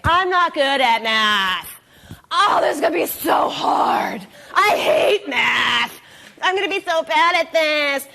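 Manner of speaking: dramatic complaining student, half-shouted; whiny kid. Retold or imitated. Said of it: "they come to the lesson saying".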